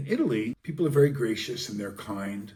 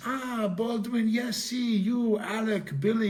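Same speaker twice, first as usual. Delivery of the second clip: high, slow put-on voice of an older Italian; delighted old Italian recognizing the Baldwin name; older Italian locals; 13 semitones higher, 4 dB louder, slower than usual. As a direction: mimic an elderly Italian fan placing the famous surname. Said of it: "some of the older people, you know, say".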